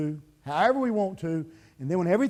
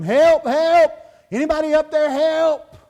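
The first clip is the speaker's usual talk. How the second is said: high, full-voiced shout; yelling for help; 9 semitones higher, 10 dB louder than usual. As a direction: cry out as if hanging from a cliff. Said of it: "he starts yelling".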